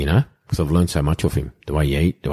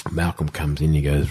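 ordinary delivery

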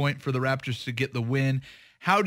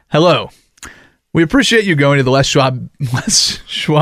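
loud hammed-up radio-ad greeting; mock announcer; 13 dB louder than usual. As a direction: boom it out like a cheesy tire commercial. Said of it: "Why can't you say tires?".

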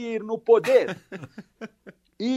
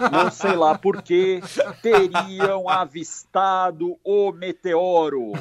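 grave urgent bulletin voice; parody news anchor; 4 semitones lower than usual. Acted. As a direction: read it like breaking news. Mock breaking news bulletin.

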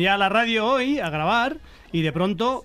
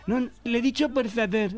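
snippy; 4 semitones higher, slower than usual. Retold or imitated. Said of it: "así, ¿tú te crees?".

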